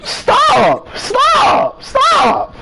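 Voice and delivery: frantic high yells of stop; shouting stop. Real, not acted. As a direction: scream stop over and over. Yelling at laughing character.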